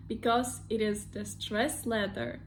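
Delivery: normal talking voice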